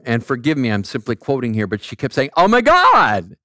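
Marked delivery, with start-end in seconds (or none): 2.4-3.4 high, stretched-out mimicked outburst; loud mock exclamation. The preacher quotes a lady at the restaurant, loud mock exclamation. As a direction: imitate a loud, drawn-out exclamation.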